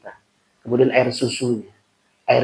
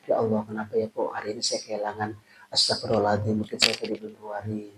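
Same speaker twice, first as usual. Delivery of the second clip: hushed, fast muttering to oneself; softly wondering aloud to God; a quick, lowered inner murmur; quiet self-talk; 3 semitones lower, 9 dB quieter, faster than usual. Retold or imitated. Imagined self-talk after losing something.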